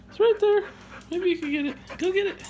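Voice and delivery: high coaxing pet voice; high-pitched dog talk. Real, not acted. Owner urging dog to get its toy, high coaxing.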